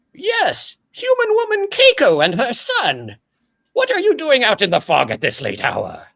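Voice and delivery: very high, fast, formal monster voice; a high, prim floating orb speaking; orb creature voice. Acted. GM as Gregory the orb, high and formal.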